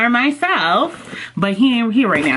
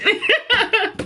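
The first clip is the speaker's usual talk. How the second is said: high giggle; 14 semitones higher than usual. Real, not acted. Bookmark pun.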